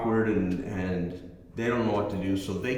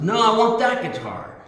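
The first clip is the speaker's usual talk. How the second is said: put-on much higher, louder voice of a demanding kid; spoiled teen; 11 semitones higher, 6 dB louder than usual. Teen son insisting to his father, high and demanding. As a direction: play the kid who won't take no.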